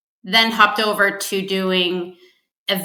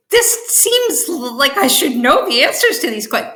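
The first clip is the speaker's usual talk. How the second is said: high, pitched-up dismay at a quiz; retelling her horror at failing a quiz, pitched up; mock horrified disbelief; 6 semitones higher, 3 dB louder than usual. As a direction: play your own shock, voice lifted well up, slowing on the first words. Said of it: "I was like".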